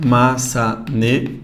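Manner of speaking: "Maçaneta" slow and low, with gaps between syllables. Shown as a demonstration. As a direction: count out four syllables in "Maçaneta". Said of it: "Professor, quatro sílabas".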